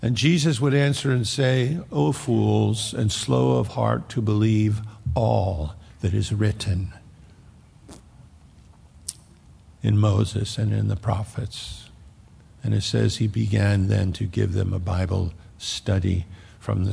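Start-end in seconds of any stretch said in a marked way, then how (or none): 1.9-11.9 mild rebuke. Acted. Jesus rebukes two disciples.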